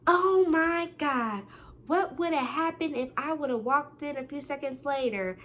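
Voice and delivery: overdone dramatic disbelief; mock shocked. Gasp, friend acts shocked.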